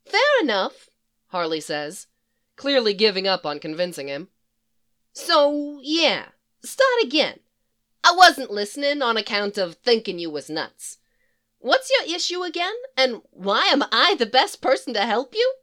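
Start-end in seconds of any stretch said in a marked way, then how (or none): 0.0-0.9 resigned Harley. Acted. Harley gives up arguing. 5.1-15.5 put-on higher, louder, sassy Brooklyn-tinged villain girl; brassy Harley Quinn voice. Harley quizzing the psychiatrist who found her, higher and brassy.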